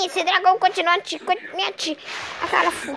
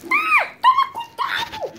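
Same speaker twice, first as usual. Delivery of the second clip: high character voice. Acted. High warning voice of a figure.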